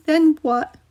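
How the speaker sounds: put-on high, anxious kid voice; scared child. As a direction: ask it like a frightened boy. Frightened young brother asks.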